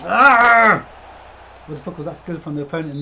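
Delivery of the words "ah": frustrated cry. Real, not acted. Missed chance in football game.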